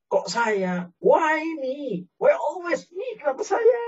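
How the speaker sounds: high rising mock wail, why me; hammed-up whining victim; whiny self-pity. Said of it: "Berpikir bahwa Allah tidak adil". (rengekan kasihan diri yang dibuat-buat)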